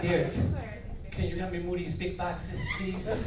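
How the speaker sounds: slow, very soft request. Retold or imitated. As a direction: coo it quietly and slowly.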